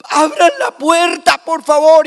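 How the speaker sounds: high, loud, crying plea; desperate plea. Retold or imitated. José begging the innkeepers, high and crying. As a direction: beg through the door in despair.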